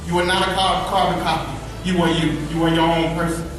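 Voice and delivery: normal talking voice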